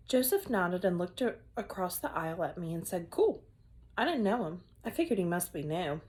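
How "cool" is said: put-on cool kid voice. New kid says cool.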